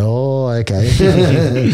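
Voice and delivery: slow, drawn-out sarcastic okay; mock-deflated okay. Recruit answering the corporal who dodged a fight, slow mock-deflated okay. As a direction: stretch it out, pretending to be let down.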